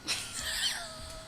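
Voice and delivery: high shrieking laugh, away from mic; far-off shriek laugh. Real, not acted. Streamer shrieking with laughter at the outfit. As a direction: shriek with glee. Reacting to fit scene.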